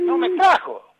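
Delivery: high-pitched, incredulous exclamation; exclaimed punchline. Real, not acted. Player telling how his friend left him behind, exclaimed.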